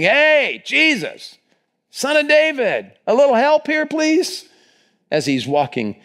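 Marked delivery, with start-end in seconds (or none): 0.0-4.5 very high, drawn-out calling out; imitated screaming. Blind beggars calling to Jesus passing by, high imitated scream. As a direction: holler like a beggar desperate for notice. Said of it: "It was just screaming".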